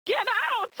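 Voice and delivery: high, jokey shout; retelling chasing the cat off the bed, mock yell. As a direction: shoo the cat off in fun. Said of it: "But I'm like".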